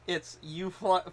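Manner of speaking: usual speaking voice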